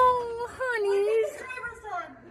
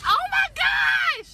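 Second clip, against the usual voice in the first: excited shout; 8 semitones higher than usual. Real, not acted. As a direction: shout it out in thrill. Excited at animal.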